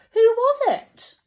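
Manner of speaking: high, loud, slow who was it; eager question. A narrator's reading. Little beaver asks the wise beaver, high and loud. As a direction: demand the answer excitedly.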